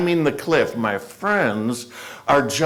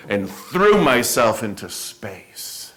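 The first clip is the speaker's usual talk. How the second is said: usual speaking voice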